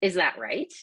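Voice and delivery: RIGHT rising sharply at the end, as a question; question inflection. Shown as a demonstration. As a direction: ask it, lifting the voice on "right". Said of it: "inflection of my voice will typically go out at the end".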